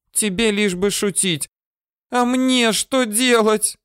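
high, plaintive female voice, drawn out at the end; tearful outburst. A narrator's reading. Girl about to burst into tears at him, tearful outburst. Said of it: "И тут девушка разревелась".

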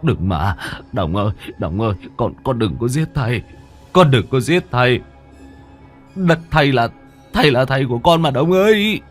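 stammered pleas rising to a high cry; frantic begging. A narrator's reading. Father begging his son not to kill him, frantic. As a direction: plead frantically, climbing in pitch and force. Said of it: "rối rít van xin".

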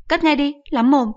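high, loud irritated snap; a mother telling her son off; shrill-free loud mother scolding. Acted. Mother orders.

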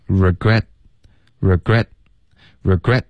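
"regret" repeated slowly, the t running straight into the next word; slow drill of "regret" with the th dropped after it; linking demo. Shown as a demonstration. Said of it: "这个th我们会咬舌尖省略". (慢速重复"regret"，省略后面的th)

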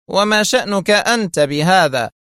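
high-pitched, stern retort. A narrator's reading. Shimon rebuffs his prisoner's question, stern. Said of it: "قال شيمون في صرامة".

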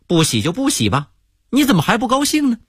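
fast, rising puzzled question; genuinely confused, pressing on. Acted. American guest asks the sulking waiter why, puzzled. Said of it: "美国人很不理解".

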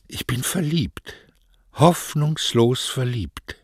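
high, yearning confession, slowing at the end; swooning young lover; lovesick declaration. Acted. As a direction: draw out the last words, hopelessly smitten. Lovesick boy declares hopeless love.

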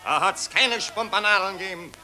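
pompous orator addressing the crowd; patriotic street speech. Acted. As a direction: harangue the citizens with fake grandeur. (pathetische Straßenrede)

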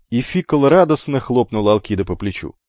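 usual speaking voice

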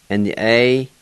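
normal talking voice

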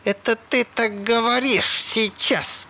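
high, slow, emphatic cry; shouted retort. A narrator's reading. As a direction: cry it back word by word. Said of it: "закричала Салли".

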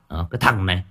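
loud, exasperated telling-off of a son; scolding parent. Retold or imitated. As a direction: scold the teenage boy hard. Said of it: "mình phải quát". (cha mẹ quát mắng đứa con trai, to tiếng)

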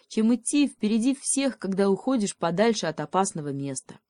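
ordinary delivery

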